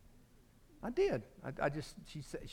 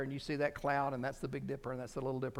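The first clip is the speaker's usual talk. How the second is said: usual speaking voice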